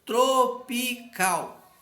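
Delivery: TRO-PI-CAL bem devagar, sílaba por sílaba; sílabas separadas. Shown as a demonstration. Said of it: "bem separadinho as sílabas". (Tropical silabado, devagar)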